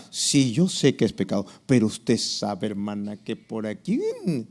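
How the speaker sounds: low, softly wheedling mock church member; lightly acted sheepish self-justification; excuse-making disciple. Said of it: "así como oramos con Dios, hablamos con el discipulador".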